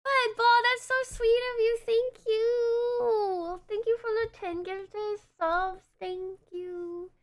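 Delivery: high, bubbly, gushing gratitude; excited thanks. Real, not acted. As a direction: squeal your thanks. Thanking a donor.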